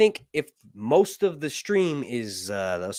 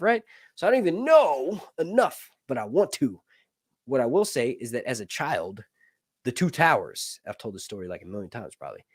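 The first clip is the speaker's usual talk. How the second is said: ordinary talk, nothing marked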